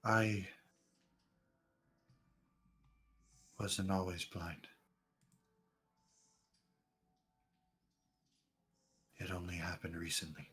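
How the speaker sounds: hushed, sorrowful blind man; soft sad stranger. Acted. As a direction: tell it quietly, haunted. NPC tells sad past softly.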